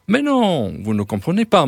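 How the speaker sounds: high, loud dismissal of a worry; emphatic reassurance. Acted. Dealer reassures the frightened old man, emphatic protest. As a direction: brush the fear aside, starting high.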